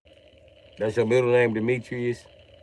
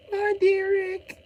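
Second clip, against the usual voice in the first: much higher, slow whiny falsetto; squeaky mock coaxing. Coaxing Derek in put-on voice.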